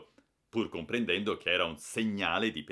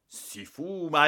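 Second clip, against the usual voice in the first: si fuma louder, higher and slow; thundering; 10 semitones higher, 7 dB louder, slower than usual. Acted. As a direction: hammer out si fuma in rage. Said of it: "tonò".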